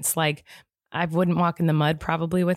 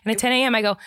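normal talking voice

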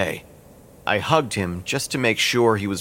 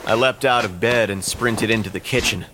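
usual speaking voice

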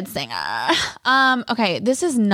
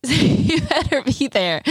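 slow, higher, drawn-out playful warning; imitating the church lady; 6 semitones higher, slower than usual. Quoting the lady insisting she come, slow and higher. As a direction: stretch it out like a sweet lady teasing. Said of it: "she's like".

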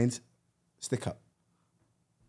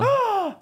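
mock shocked gasp. Real, not acted. Hearing Perth is English-filled.

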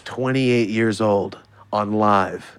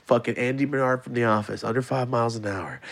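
ordinary talk

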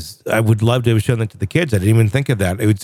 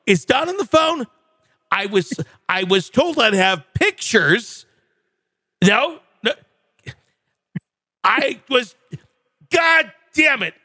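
higher, angry radio-host outtake tirade; Casey Kasem rant; 7 semitones higher than usual. Retold or imitated. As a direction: rant like a furious DJ off-air. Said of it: "Casey Kasem".

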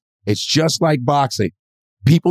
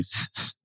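sharp "tss, tss" through the teeth; imitated punching hisses; 9 dB quieter than usual. Novice copying boxers' punch noises, mock hissing. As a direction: mimic a boxer's hissed breaths. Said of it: "I started going".

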